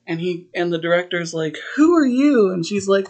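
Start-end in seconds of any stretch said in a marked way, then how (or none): 1.7-2.5 high, loud mock demand; indignant challenge. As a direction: act out an affronted director. Director challenging strangers at rehearsal.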